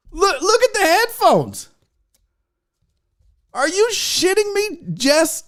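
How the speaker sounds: high-pitched, amazed exclamation; incredulous delight. Real, not acted. Unboxer noticing painted headphones, incredulous delight. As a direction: point it out high, in disbelief.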